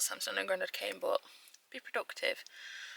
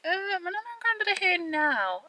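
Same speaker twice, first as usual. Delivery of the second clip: 1 mimicking Sam; 11 semitones higher, 3 dB louder than usual. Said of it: "he was like".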